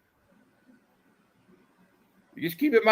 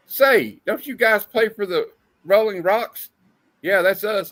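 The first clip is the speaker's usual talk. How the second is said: comic characters voice; 7 semitones higher, 3 dB louder, slower than usual. Acted. Reading Archie panel dialogue.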